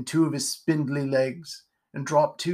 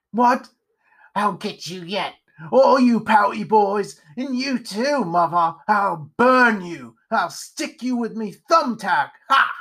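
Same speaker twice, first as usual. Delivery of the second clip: loud, high, drawn-out threats; raving vengeful Pumpkinhead; 6 semitones higher, 4 dB louder than usual. Acted. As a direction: rave crazily at imagined enemies.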